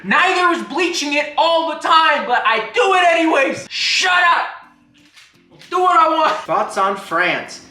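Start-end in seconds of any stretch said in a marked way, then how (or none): 0.0-3.7 laughing. Real, not acted. Laughing defiant rant. 3.7-6.3 defiant. Acted. Playful defiance.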